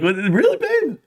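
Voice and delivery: high squeak on babe; mock disbelief. Husband asking wife if she's serious, high squeak. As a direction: sound comically stunned. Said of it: "I was like".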